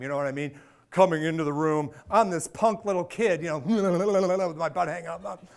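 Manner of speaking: usual speaking voice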